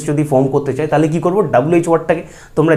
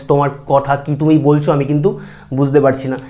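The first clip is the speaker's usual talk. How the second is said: usual speaking voice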